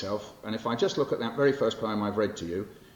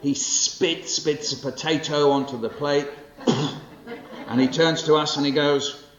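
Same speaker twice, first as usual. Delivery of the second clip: bright, bouncy storytelling read, higher and louder; lively poem performance; 5 semitones higher, 4 dB louder than usual. Acted. Poet performing his childhood poem to the audience, lively and animated.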